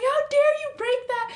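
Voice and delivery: high tearful accusation; tearful outrage. Retold or imitated. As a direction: cry it at him, voice cracking high. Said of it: "run after him".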